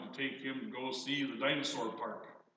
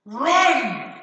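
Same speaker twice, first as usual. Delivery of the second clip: put-on much higher, loud child's yell; yelled warning; 12 semitones higher, 17 dB louder than usual. Little boy fleeing the dinosaur yells to his parents, panicked yell. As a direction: scream it like a fleeing toddler. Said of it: "he yells".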